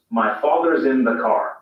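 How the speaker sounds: rhotic American reading, every R sounded; American English demo sentence; General American. Shown as a demonstration. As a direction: say it in General American with a clear R in father's and car. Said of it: "Listen to the difference".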